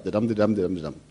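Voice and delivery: soft dum de dum beat, stress on each dum; "de dum" chanted as a quiet iambic pulse; iambic rhythm. Shown as a demonstration. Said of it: "Remember the iambic pentameter".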